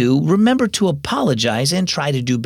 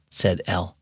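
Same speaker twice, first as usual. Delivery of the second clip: ordinary delivery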